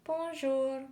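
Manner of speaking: "bonjour" high, in a French accent; French pronunciation. Shown as a demonstration. Narrator shows how to say hello, high French pronunciation. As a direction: model "bonjour" with a lifted French sound. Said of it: "You say it like this".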